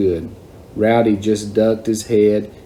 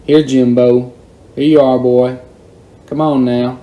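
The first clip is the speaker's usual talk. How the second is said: louder coaxing call; 3 semitones higher, 5 dB louder than usual. Retold or imitated. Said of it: "started calling again".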